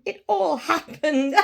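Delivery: laughing through the words. Acted. Scrooge in his sitting room, laughing with relief. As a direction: let the laugh break in.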